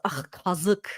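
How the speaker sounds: quiet, disgusted ugh, kazık. Shown as a demonstration. Teaching viewers the word, quiet disgust. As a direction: say kazık like an insult. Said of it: "almost like an insult".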